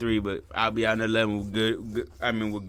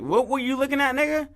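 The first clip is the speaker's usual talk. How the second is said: high-pitched mock challenge; spoiling for a fight; 12 semitones higher than usual. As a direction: act out picking a fight, pitch jumping up. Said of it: "fiending for a fight".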